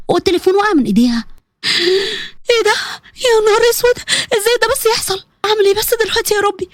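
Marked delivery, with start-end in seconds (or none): 1.6-2.3 sharp, acted gasp of horror; shocked gasp. Girl seeing a compromising video of herself, shocked gasp. Salma sees the video, horrified. 2.5-6.7 high, panicky lament; panicked. Acted. Girl alone after seeing the video, high-pitched panic.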